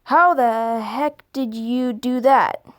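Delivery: slow, lightly voiced puzzled boss; puzzled supervisor. Supervisor asks the next evening, slow and baffled. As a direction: drag it out, baffled. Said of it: "gave me a weird look and asked".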